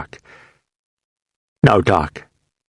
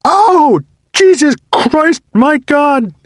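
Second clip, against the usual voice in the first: much higher, louder agonized scream; screaming in pain; 5 dB louder, slower than usual. A narrator's reading. Wounded soldier rolling over, screaming in agony. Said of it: "Marston tried and screamed".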